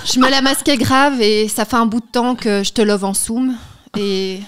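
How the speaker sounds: reading a love letter with flair; dramatic letter reading. Acted. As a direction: perform the letter like a declaration. Reads a love letter aloud.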